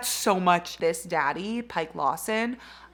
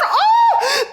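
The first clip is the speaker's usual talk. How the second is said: high, loud delighted cry; giddy shriek at a romance plot; excited squeal; 10 dB louder than usual. Real, not acted. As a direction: squeal it with glee. Squeals at the book.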